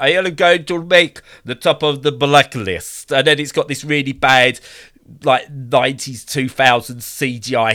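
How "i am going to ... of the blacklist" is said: a cheesy, hammed-up Eastern European accent, pitched higher and louder; game character boasting in a bad Eastern European accent. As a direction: do a deliberately bad Eastern European villain line. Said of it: "I'm gonna do a really bad accent now".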